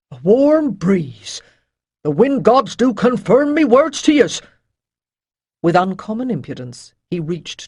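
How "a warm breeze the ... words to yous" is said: mocking rough sailor. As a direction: sneer at the mage. Avon the sailor mocks.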